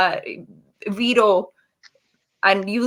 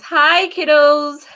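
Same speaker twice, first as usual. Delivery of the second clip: cheery greeting; 7 semitones higher, 7 dB louder, much slower than usual. Real, not acted. Greeting the kids watching.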